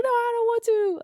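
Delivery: high mock little-boy whine; high-pitched pouty child voice; child refusing. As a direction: imitate a kid digging in. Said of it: "He's like".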